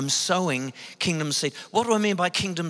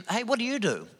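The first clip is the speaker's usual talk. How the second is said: casual matey "Hey, what do you do?" pitched higher; blokey small talk; 6 semitones higher, 4 dB quieter than usual. Retold or imitated. Man asking a stranger on a plane, casual blokey question. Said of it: "a man thing that men do".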